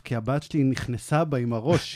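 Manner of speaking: ordinary talk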